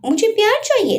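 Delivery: "मुझे प्यार चाहिए" cried out high, "प्यार" hit hard; angry cry. Acted. Wife angrily demands love instead of her husband's worry. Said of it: "गुस्से में चिल्लाते हुए बोली".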